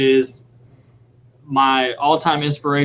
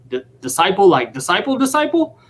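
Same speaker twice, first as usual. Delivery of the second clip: imitating a stunned star who can't believe it; disbelieving double take; 4 semitones higher than usual. Retelling Wov's stunned realization, rising disbelief. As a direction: pitch climbing higher on each repeat, the last one way up. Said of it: "And he goes".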